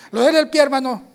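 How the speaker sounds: high, loud acted question; imitated questioner. Acts out people questioning him.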